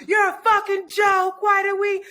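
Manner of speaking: high, heated imitation of fed-up voters; mock outraged voters. Mimics angry Democrats berating Biden.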